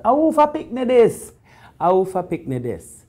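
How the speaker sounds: high Jamaican yell across a party. Retold or imitated. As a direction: call out to find the child's parent. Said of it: "somebody yell out".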